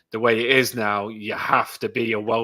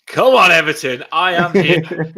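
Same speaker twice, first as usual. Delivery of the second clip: cheering entrance; 5 semitones higher, 11 dB louder than usual. Real, not acted. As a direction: shout it like a fan arriving. Guest bursts in cheering his team.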